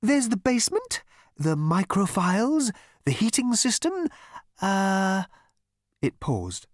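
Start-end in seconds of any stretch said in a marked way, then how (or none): 0.0-5.2 high, syrupy elevator voice; honeyed. A narrator's reading. Elevator offering Zaphod alternatives, honeyed and high. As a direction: list it sweetly, pitched high, like honey trickling. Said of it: "the voice trickled on like honey on biscuits".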